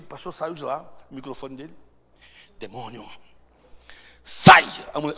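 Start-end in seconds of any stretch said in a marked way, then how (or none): 2.6-3.1 low, soft address to the demon; solemn exorcism build-up. Retold or imitated. Pastor at microphone facing the demon, low solemn address. 4.2-4.7 sudden loud shouted order; explosive command. Retold or imitated. Pastor casting the demon out, explosive shout.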